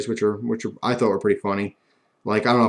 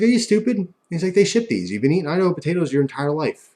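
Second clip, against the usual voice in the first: mocking grandfather; 7 semitones higher than usual.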